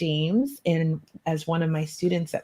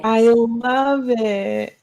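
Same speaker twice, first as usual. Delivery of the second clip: slow, drawn-out and louder; slow delighted thanks; 5 semitones higher, 6 dB louder, much slower than usual. Real, not acted. Host thanks her co-host for the silk kimono, delighted. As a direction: savour the words, full of delight.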